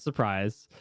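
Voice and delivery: ordinary talk, nothing marked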